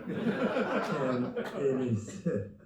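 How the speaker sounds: ordinary delivery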